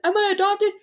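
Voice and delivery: put-on whiny kid voice. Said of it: "she's like".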